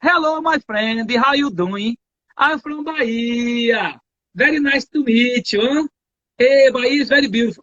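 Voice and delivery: English with a light Bahian lilt; lightly voiced Bahian speaking English, slow; Bahian accent. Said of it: "O baiano chega e fala assim". (inglês com leve sotaque baiano)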